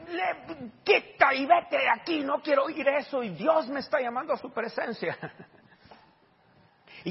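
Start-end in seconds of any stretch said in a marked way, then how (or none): 0.1-5.2 higher, louder, slow, shooing him away; retold angry old man. Brother with crutches telling the preacher to leave, angry. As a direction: push the preacher off, cross and stubborn.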